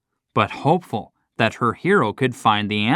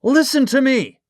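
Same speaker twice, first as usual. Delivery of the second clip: high, loud call; shouting; 14 semitones higher, 3 dB louder than usual. A narrator's reading. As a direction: shout through the vault door. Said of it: "he shouted as loudly as he could".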